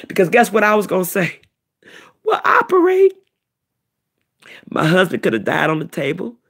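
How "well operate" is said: high, crying "Well, operate."; giving in through tears; tearful surrender. Retold or imitated. Mother recalling almost agreeing to surgery, crying. She nearly gave in, tearful.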